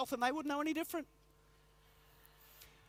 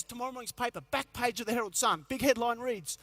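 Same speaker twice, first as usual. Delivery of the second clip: ordinary delivery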